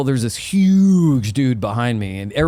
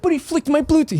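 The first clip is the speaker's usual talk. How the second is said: high whining protest; whiny complaint. Retold or imitated. Agent whining to the bouncer, high and petulant. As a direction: whimper it like a tattletale.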